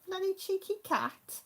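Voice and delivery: fond pet-owner scolding in a high coo. Retold or imitated. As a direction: tell the cat off fondly, way up high. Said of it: "I said".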